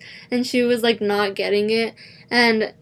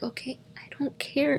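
inner thought; 3 semitones higher, 9 dB quieter than usual. Retold or imitated. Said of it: "In my head, I didn't say that to her".

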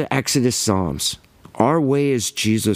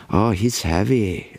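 mock guru; slower than usual. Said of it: "everyone says".

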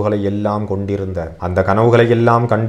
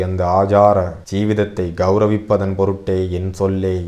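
ordinary delivery, nothing marked

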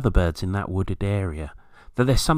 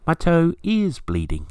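high, slow cooing imitation; drawn-out high pigeon coo; wood pigeon call; 9 semitones higher than usual. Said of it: "it sounds more like".

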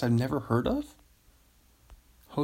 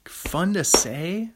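slow and higher, rising with glee; delighted; 6 semitones higher, 3 dB louder, slower than usual. Shown as a demonstration. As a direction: relish the title with a gleeful rise. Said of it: "Come on".

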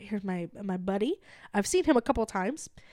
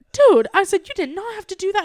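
high, loud, emphatic retold protest; touched protest; 8 semitones higher, 8 dB louder than usual. Customer to barista after free gift card, touched protest. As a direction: burst out in overwhelmed gratitude.

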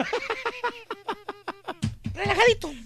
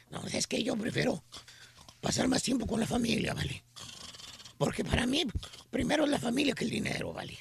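low, soft self-important rasp; raspy voice; 7 semitones lower, 11 dB quieter than usual. Retold or imitated. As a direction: play it serious and important with a rasp. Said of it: "te contesta".

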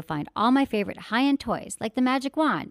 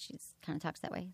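ordinary talk, nothing marked

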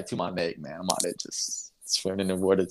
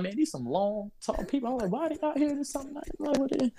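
much higher, squeaky puzzled wondering; 3 dB quieter than usual. Retold or imitated. On the dark beach, wondering aloud in a high puzzled voice. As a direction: sound baffled and uneasy. Retold confusion at dark shapes.

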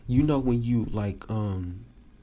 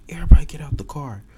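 fast, louder and higher command; barked cop order; 5 semitones higher, 12 dB louder, much faster than usual. Retold or imitated.

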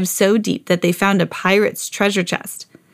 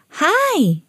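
high sweet doll voice; 10 semitones higher than usual. A narrator's reading. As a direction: voice Bitty Baby high and small. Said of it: "said Bitty Baby".

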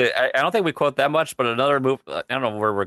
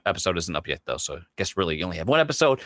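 normal talking voice

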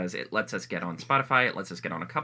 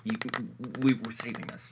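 whispering. Real, not acted. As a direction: whisper it close to the mic. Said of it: "Keep whispering".

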